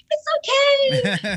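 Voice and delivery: very high, slow sing-out; sweetly over-cheerful, stretched okay; smiling reassurance. Retold or imitated. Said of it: "like, smiling".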